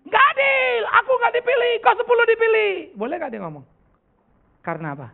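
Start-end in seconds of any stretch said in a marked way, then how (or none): 0.1-2.7 high, loud complaining voice; whiny protest. Retold or imitated. The unchosen forty protest to God, whining high and loud. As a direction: whine loudly that it's unfair. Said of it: "Empat puluh bisa ngomong nggak?".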